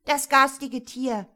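loud, high grumbling outburst; angry scolding. A narrator's reading. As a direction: scold in a temper. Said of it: "zankte Marie".

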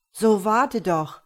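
calling out. Acted. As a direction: call him back, drawn out and loud. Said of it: "rief jemand neben ihm". (laut und langsam rufend)

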